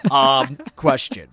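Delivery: character voice. Acted. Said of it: "this is gonna maybe be my character voice".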